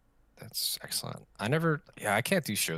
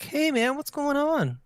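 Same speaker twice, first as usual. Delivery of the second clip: much higher chirpy greeting; high-pitched cheery voice; 5 dB louder, slower than usual. Pretend. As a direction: greet a robot brightly. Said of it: "you'd be like".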